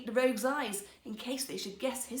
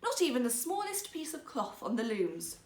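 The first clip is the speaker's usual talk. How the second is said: usual speaking voice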